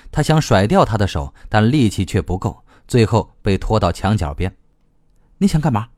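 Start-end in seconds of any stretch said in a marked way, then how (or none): 5.4-5.9 mildly angry question, pitched high; angry demand. A narrator's reading. Cornered against the wall by the other, angry. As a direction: ask it in raised-pitch annoyance. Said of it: "他怒气冲冲地问道".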